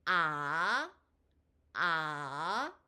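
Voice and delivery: "아" held long, starting low and rising steeply like a question; second-tone rise. Shown as a demonstration. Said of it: "낮은 음에서 높은 음으로 올라가는". ("아"를 낮은 음에서 높은 음으로 길게 끌어올리기)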